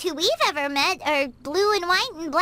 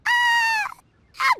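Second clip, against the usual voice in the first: high-pitched startled stall; flustered; 13 semitones higher than usual. Acted. Friend asked if they are Adepti, flustered. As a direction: caught off guard, a high "uh". Caught out about secret identity.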